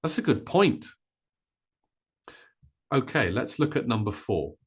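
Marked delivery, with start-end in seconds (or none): 0.0-0.9 "point" punched high and long, "good" lighter; stress on point. Shown as a demonstration. Teacher modelling sentence stress to learners, stress on "point". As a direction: race through "That's a" and land loudly on "point". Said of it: "point becomes loud and long".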